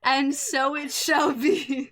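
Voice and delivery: slow, grand proclamation; dramatic pronouncement. Acted. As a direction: intone it like fate. GM grants the wish dramatically.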